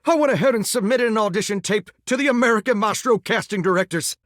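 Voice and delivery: ordinary delivery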